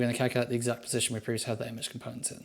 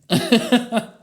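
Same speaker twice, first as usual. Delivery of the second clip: burst of laughter; 11 semitones higher, 11 dB louder than usual. Real, not acted. Code is the wrong way.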